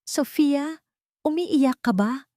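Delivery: high, slow, concerned question; worried friend. A narrator's reading. Friend on the phone asking if she is crying, worried. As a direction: ask gently, full of worry. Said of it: "nag-aalalang tanong ng kaibigan".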